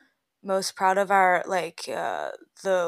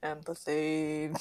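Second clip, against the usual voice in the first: ordinary delivery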